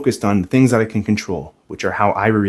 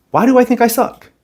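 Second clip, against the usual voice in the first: pitched way up, incredulous at himself; high self-questioning; 13 semitones higher, 4 dB louder than usual. Pretend. Questioning his own negative thought, pitched up high. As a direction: challenge the thought as if it's absurd.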